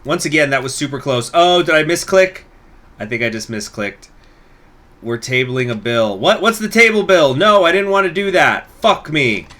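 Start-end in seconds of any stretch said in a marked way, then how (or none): 1.3-2.4 dismayed. Real, not acted. Misclick in game. 6.2-9.5 high, loud dismayed protest; alarmed protest. Real, not acted. Gamer protests the bill he enacted by mistake, dismayed.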